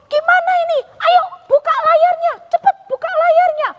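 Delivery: high, urgent calls to open the sail; frantic sailors shouting orders; panicked disciples. Acted. Disciples panicking in the storm, high urgent calls. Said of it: "murid-muridnya mulai bingung".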